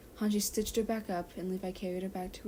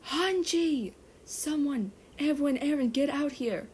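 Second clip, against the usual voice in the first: loud, high frantic call for help; shouting for help; 7 semitones higher, 6 dB louder than usual. Acted. Levi shouting to the HQ for help, frantic. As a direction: yell out desperately for anyone to come.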